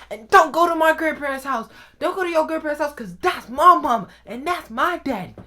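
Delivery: high, loud forbidding order; angry mom warning. Retold or imitated. Mom banning grandparents' house, loud high warning. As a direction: imitate a mother laying down the law.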